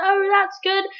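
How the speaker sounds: hammed-up star hurrying fans on; mock dismissive celebrity. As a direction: wave the fan along politely. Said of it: "it's like".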